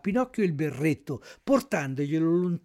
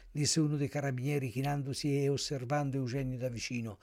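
ordinary delivery, nothing marked

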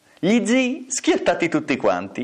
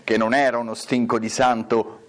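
plain reading voice

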